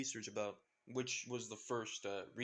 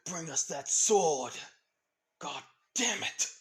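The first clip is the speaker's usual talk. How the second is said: loud, slow barked demand; loud angry henchman shouting; yelling thug; 3 semitones higher, 8 dB louder than usual. Acted. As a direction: bellow the order at the car. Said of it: "some person yelled out saying".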